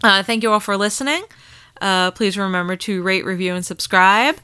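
ordinary talk, nothing marked

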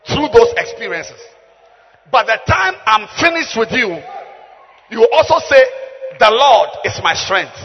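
loud, emphatic preacher's proclamation; full-force preaching. Real, not acted. Preacher promising the congregation a transformation, full-force preaching. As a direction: drive each phrase home at volume.

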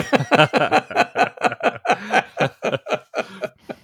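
big real burst of laughter, high; hearty laughing fit; long laugh. Real, not acted. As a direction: laugh it off at the fuel prices. Laughs at own fuel-price joke.

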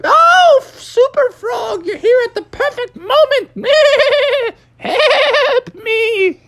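very high bleating goat voice; high, drawn-out goat wail; billy goat shouting. Acted. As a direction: bleat desperately for rescue. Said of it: "He was shouting out".

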